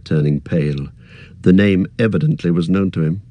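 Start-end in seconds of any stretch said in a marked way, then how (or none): none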